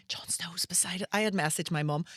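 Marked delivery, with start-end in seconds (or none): 0.1-1.1 hushed, unvoiced-sounding whisper on the sly; whispered so the next table can't hear; excited whisper. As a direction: whisper it like secret celebrity news. Secretly excited about celebrity nearby.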